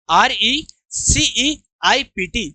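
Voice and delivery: very slow letter-by-letter R E C E I P T; drawn-out dictation for the class; spelling aloud. Shown as a demonstration. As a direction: spell it very slowly, pausing between letter groups. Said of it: "मेरे साथ बोलो".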